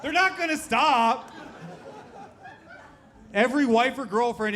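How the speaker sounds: hammed-up, stretched-out protest; mock yelling. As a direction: call it out high over the crowd. Comic yells over crowd reaction.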